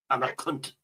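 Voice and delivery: ordinary talk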